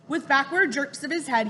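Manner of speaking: ordinary delivery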